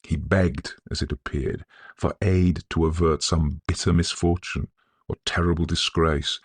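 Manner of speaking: plain reading voice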